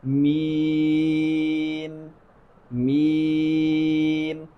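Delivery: "میم" held very long on a level note, m only at the end; slow, chant-like sustained "میم"; correct meem. Shown as a demonstration. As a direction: stretch the pure ee of "میم" before closing. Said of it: "ایسے می نہیں پڑھنا بلکہ می پڑھنا ہے".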